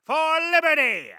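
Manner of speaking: loud, high rallying shout; battle cry. Acted.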